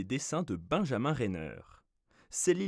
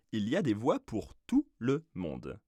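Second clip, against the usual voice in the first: ordinary talk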